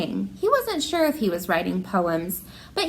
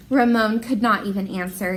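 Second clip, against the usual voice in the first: normal talking voice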